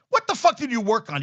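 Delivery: loud, high-pitched incredulous demand; angry challenge. Real, not acted. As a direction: throw it back as a furious question. Angry rebuttal to coach's remark.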